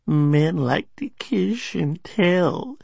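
"kiss" slurred to "kish", high and slow; drunken slur. A narrator's reading. Well-bagged woman gossiping, slurs "kiss" as "kish". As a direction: drag it out tipsily, "kiss" with a sh ending. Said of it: "She might have said, "Kish".

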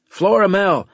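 loud, high shout into the comms; demanding call. A narrator's reading. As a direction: call out the name, demanding an answer. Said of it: "I demanded".

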